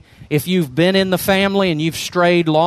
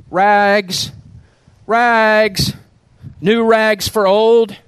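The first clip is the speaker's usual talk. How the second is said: higher, louder, slow street cry; clear tenor call; 5 semitones higher, 3 dB louder, much slower than usual. Acted. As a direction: let the call carry down the street. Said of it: "calling in a clear tenor voice".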